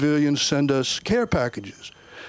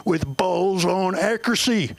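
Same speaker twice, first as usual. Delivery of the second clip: Southern armorer. Retold or imitated. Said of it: "And he goes".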